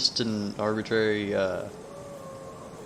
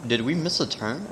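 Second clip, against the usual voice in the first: normal talking voice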